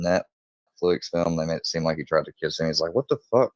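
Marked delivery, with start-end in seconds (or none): 2.9-3.5 higher-pitched retold shock; mock-startled, pitched up; taken aback. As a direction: act out a startled, offended reaction. Retold startled reaction to advance.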